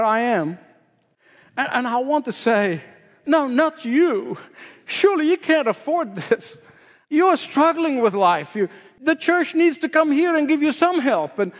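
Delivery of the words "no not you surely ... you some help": high, pleading "No, not you" in disbelief; foolish protest. Retold or imitated. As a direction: protest like the poor can't possibly give. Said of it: "some foolish statements like this".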